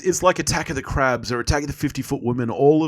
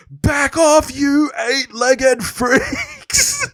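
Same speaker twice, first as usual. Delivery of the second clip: high, drawn-out mock hero shout; imitated movie yell; 13 semitones higher, 4 dB louder, much slower than usual. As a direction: yell the title line with full ham. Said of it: "he's literally yelling out".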